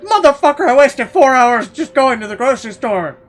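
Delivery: furious outburst. Retold or imitated. Shopper snapping at onlookers, loud furious yell. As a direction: blow up at them. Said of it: "And I'm like".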